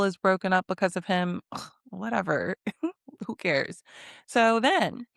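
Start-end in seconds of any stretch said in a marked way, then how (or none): none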